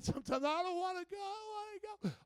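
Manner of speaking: put-on high, strained childish whine, rising; whiny reluctance. Believer resisting being led by the shepherd, whining in protest. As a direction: whine it up high, dragging your heels.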